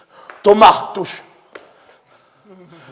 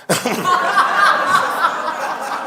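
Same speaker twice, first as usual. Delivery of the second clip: hearty laugh; 11 semitones higher, 4 dB quieter than usual. Real, not acted. Preacher laughs at own joke.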